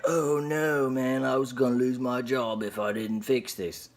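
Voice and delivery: put-on British accent; mock British. FedEx worker afraid of losing his job, mock British. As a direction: play a worried worker with a makeshift British accent. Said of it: "I don't know why he's British".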